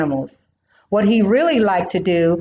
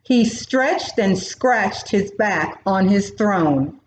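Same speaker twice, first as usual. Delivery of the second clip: plain reading voice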